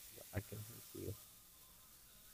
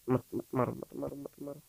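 frantic begging. Real, not acted. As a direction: beg for your life. Begging sweeper not to kill.

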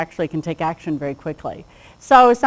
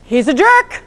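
amused; 8 semitones higher, 6 dB louder, slower than usual. Shown as a demonstration.

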